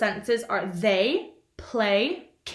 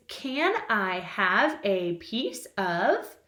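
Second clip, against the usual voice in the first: slow, clear dictation; 3 semitones lower, much slower than usual. Shown as a demonstration. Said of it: "and it is the sentence".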